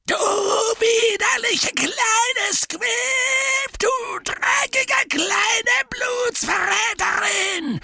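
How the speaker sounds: high, slow furious roar. A narrator's reading. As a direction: roar with fury. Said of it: "brüllte Gaunt".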